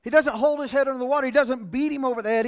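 loud, driving preacher's cadence; rising sermon fervor; preaching at full force. Acted. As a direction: hammer the point home to the congregation.

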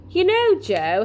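high start, gently drawn out; measured lead-in to advice; slow, considered. A narrator's reading. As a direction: begin carefully, choosing words. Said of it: "he said slowly".

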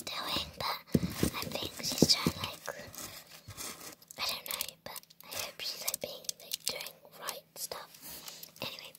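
hushed aside whisper. Real, not acted. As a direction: whisper so she can't hear. Whispering aside about sister.

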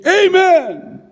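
high, loud front-row shouts; front-row man yelling out during the teaching. Retold or imitated. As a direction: holler each praise at full voice. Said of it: "he would always yell out".

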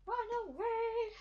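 high mock panic; panicked squeal. Real, not acted. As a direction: squeal it like a cartoon coward. Fleeing the killer, squealing.

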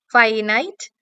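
"ഫൈനൈറ്റ്" as fie-nite, ending on a rise; spelling pronunciation. Shown as a demonstration. Teacher repeating the middle of definitely for spelling, spelling pronunciation. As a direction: say "ഫൈനൈറ്റ്" with two long i sounds. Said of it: "എന്നുള്ള രീതിയിൽ".

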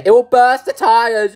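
a touch of a whiny critic; lightly voiced nitpicking viewer; mock complainer voice. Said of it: "They say".

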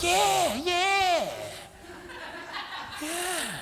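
high, drawn-out dazed yeahs; mindless agreeing. Retold or imitated. Listener agreeing with the devil's fast talk, high and dazed. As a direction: nod along in a high daze. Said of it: "You'd be like".

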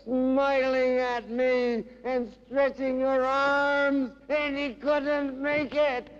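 declaimed poem, climbing to a high, ringing pitch; dramatic recital with rising intensity; impassioned poetry reading. Acted. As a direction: start measured, build to fervent high-pitched recitation.

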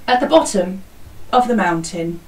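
ordinary talk, nothing marked

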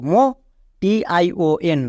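usual speaking voice

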